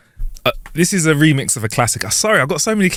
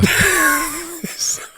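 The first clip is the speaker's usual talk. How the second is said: laughing; 4 dB quieter than usual. Real, not acted. Laughs at punchline.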